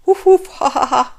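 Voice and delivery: loud, high woofing laughter; barking laugh. Acted. Dog jeering at Shelley, barking laughter.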